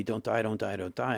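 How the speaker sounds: ordinary delivery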